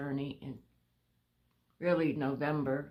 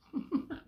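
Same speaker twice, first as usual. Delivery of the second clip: short breathy laugh; 11 semitones higher than usual. Real, not acted. Laughs at own uncertainty.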